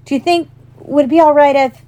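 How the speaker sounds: put-on high, eager boy stumbling over his request; hopeful. A narrator's reading. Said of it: "he said, raising the camera hopefully".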